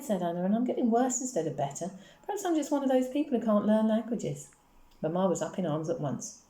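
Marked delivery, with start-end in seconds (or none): 0.4-4.5 put-on high, weary little girl's voice; plaintive child. A narrator's reading. Daughter confiding about school, plaintive. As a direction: complain in a pitched-up child's voice.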